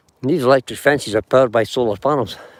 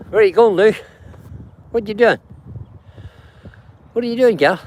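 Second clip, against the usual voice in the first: calling out; 9 semitones higher, faster than usual. Real, not acted. Calling out to the horses.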